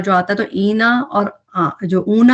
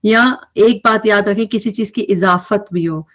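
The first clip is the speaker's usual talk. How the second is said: ordinary talk, nothing marked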